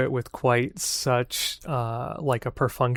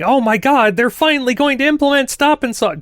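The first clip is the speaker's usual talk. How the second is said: much higher and louder, fast gushing fanboy; mock hype; 11 semitones higher, 7 dB louder, faster than usual. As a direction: ham up the overexcited fan, fast. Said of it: "don't hype yourself up for that".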